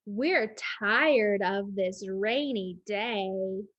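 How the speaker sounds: very slow weary storybook line; slow story reading. Acted. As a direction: drag it out, tired of rain. Reading book text.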